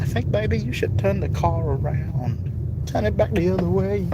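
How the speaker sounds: lightly put-on Filipino accent; a hint of a Filipino accent. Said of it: "So switching to Filipino".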